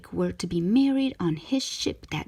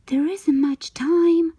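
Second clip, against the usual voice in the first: higher, louder, slow urgent worry; worried thought; 9 semitones higher, 7 dB louder, slower than usual. Acted. Ariel fretting on the boat ride, urgent and slow. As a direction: time is running out.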